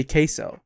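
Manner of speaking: "queso" with an American long A, KAY-so; American pronunciation. Shown as a demonstration. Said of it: "bastardize it with our American accent".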